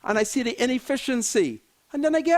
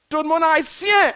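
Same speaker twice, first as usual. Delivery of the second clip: mock Haitian Creole; 6 semitones higher, 6 dB louder than usual. Mocking ministers by switching to Haitian Creole, theatrical. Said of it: "say that in Haitian Creole".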